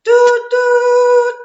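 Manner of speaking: high, loud train whistle toots. Shown as a demonstration. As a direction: toot like a choo-choo train.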